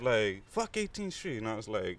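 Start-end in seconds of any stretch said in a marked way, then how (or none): 0.5-1.4 high, softer mock yell; hostile taunt. Armed bikers taunt newcomer about the street, hostile. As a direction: imitate a gang's cry without the volume. Acts out gang's hostile cry.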